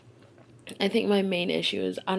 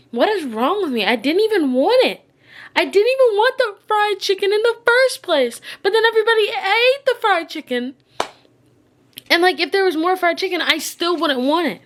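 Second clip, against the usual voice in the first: high, loud frustrated outburst; upset rant; 11 semitones higher, 8 dB louder than usual. Real, not acted. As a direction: vent it, pitch climbing. Venting frustration about the chicken.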